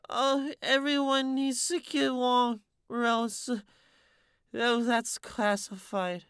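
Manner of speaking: put-on high, very slow drunken mumble; drowsy slur, drifting off; slurred mumble.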